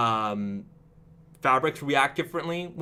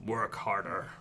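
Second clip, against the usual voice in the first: 低沉霸道的老板命令; angry corporate overlord; 5 semitones lower, 8 dB quieter, slower than usual. Pretend. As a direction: bark it like a greedy boss. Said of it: "angry corporate overlord voice".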